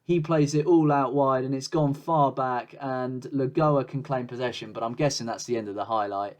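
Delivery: usual speaking voice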